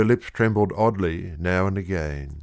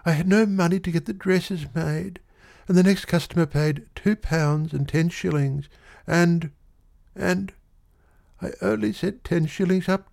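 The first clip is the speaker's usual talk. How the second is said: put-on much higher, anxious young woman confessing; tearful girl; 10 semitones higher than usual. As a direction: spill it all out near tears. Girl confesses between sobs.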